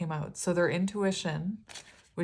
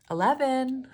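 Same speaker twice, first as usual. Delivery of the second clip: excited; 7 semitones higher, 5 dB louder than usual. Real, not acted. Excited reveal of the number.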